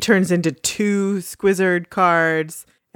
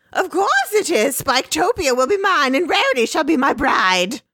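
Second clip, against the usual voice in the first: very high, grandiose villain voice; squeaky villain; 11 semitones higher than usual. Retold or imitated. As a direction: gloat like a petty overlord. Squizzard villain voice.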